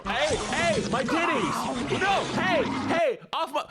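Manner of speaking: very high, squealed protest; alarmed yelping. Real, not acted. Streamer fending off a sudden attack, alarmed yelping. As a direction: yelp frantically at the attacker.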